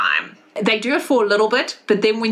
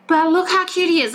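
high cooing parent voice; doting parents; 7 semitones higher than usual. Acted. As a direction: gush over the little one, pitched up. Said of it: "And then they're like".

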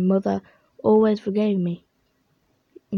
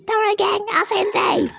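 dopey cartoon bellow; 11 semitones higher than usual. Hero boasting.

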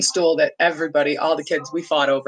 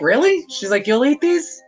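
high, surprised question; surprised grandma; 8 semitones higher, 4 dB louder than usual. Retold or imitated. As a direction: ask in happy disbelief. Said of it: "so she's like".

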